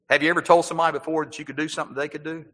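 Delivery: normal talking voice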